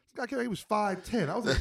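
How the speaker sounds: ordinary talk, nothing marked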